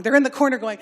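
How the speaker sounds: ordinary delivery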